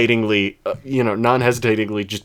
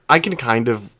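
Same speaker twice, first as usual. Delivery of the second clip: normal talking voice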